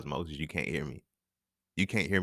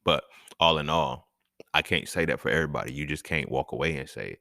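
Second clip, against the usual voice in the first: normal talking voice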